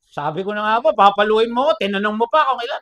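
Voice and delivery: put-on loud, cocky kid talking back; defiant child. Child accusing the principal of planning a spanking, defiantly. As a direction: act a stubborn child, punching it out loudly. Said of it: "Sabi nung bata".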